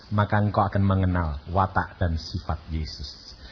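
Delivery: normal talking voice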